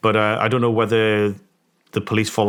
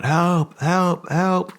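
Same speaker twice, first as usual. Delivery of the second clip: imitated scream for help; 9 semitones higher, much slower than usual. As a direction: echo a girl's frightened screams, pitched up. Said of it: "I can hear this girl screaming".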